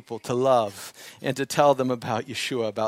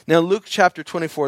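ordinary delivery

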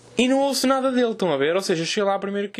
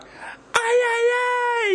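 very high, hammed-up squeal; mock scream; 3 dB louder, much slower than usual. Man trying the signal once more across the cinema, mock scream. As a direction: send the signal out as a high comic cry. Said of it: "Volto a gritar".

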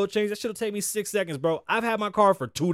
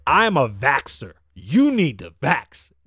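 slow, louder, lower mock pharmacist; 4 semitones lower, 6 dB louder, slower than usual. Quoting the Walgreens pharmacist pushing the vaccine, slow and loud. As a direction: drag it out like a preachy pharmacist. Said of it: "looked me in my face and said".

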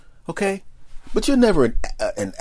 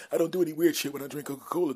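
usual speaking voice

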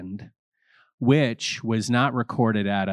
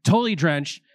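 ordinary delivery

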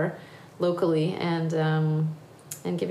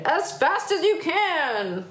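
high, stretched-out urging; urgent cry; 9 semitones higher, slower than usual. Acted. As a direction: call out to fleeing riders. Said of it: "cried Grandude".

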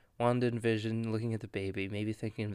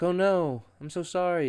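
mock apology; 6 semitones higher, 3 dB louder than usual. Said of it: "Vision's like".

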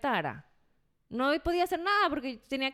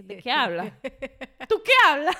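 mock disbelief; 3 semitones higher, 10 dB louder than usual. Wife reacting to husband's claim he slept badly, loud laughing disbelief. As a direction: scoff at him through laughter.

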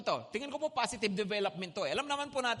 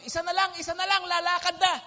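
higher, louder call of a bus barker; barker's old call, repeating "Isa na lang" loud and high; mock barker shout; 7 semitones higher, 6 dB louder, faster than usual. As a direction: hawk the last seat like a barker hurrying riders on. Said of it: "Suma-, sumisigaw".

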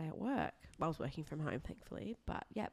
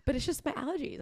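mock defensive; 11 semitones higher than usual. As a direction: insist primly that you're clean, voice pitched up. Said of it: "And I'm just like".

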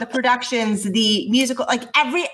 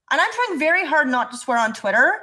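ordinary talk

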